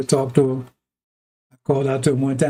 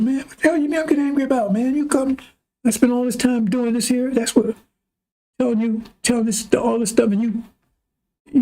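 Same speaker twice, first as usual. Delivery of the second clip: much higher, fast indignant protest; angry outburst; 9 semitones higher than usual. Retold or imitated. Preacher reenacting his angry complaint to God, high heated protest. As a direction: blow up at God for making you look foolish. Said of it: "he really got angry at the God".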